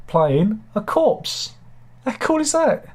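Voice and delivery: delighted to hear a corpse gets cast; excited. Real, not acted. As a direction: gush like it's the best news ever. Excited about corpse role.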